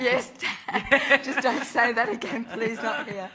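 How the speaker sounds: hammed-up embarrassed yell back at dad, laughing; mock screaming. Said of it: "And I'd go screaming out".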